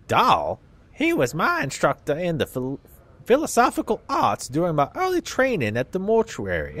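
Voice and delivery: put-on southern accent. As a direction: play a crusty old Southern dustman in a Southern accent. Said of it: "give old people southern accents".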